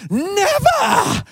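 very high Welsh cry of disbelief; Welsh accent exclaim. Retold or imitated. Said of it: "with a thick Wales accent".

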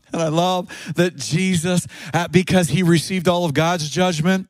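ordinary talk, nothing marked

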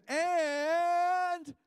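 "And" stretched out, high and loud; emphatic AND. Real, not acted. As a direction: belt out a long, held "And". Emphatic stretched word before the promise.

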